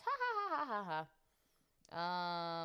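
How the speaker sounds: embarrassed laugh about watch history. Real, not acted. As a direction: laugh at your own embarrassment.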